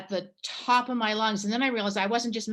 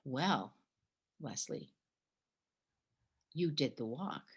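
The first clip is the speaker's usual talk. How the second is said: soft, near-whispered reassurance; gentle reply; 4 semitones lower, 8 dB quieter than usual. Retold or imitated. The hospitalier quietly reassuring the exhausted pilgrim, soft and gentle. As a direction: say it softly, like a kind host. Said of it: "and he said".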